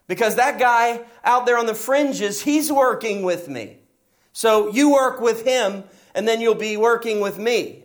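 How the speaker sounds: firm but playful plea to pull together; joking but emphatic. Retold or imitated. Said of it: "basically what he said to me".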